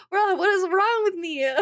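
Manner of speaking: high, loud mock exasperation at herself; exasperated self-quote. Streamer quoting her own moody outburst, high and exasperated. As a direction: throw the question up, half laughing. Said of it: "I'm like".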